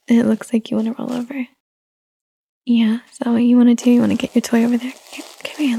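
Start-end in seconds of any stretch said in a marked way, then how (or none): 2.6-5.8 whispering. Real, not acted. Whispering to baby about toy.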